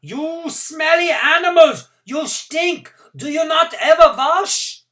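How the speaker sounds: high, loud, slow soldier's shout; harsh guard yelling abuse; jackboot shouting. Acted. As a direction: bark it down at the crowd. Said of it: "a nearby jackboot was shouting".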